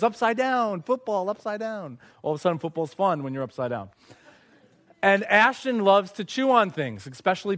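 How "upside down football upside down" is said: put-on toddler voice. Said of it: "and goes".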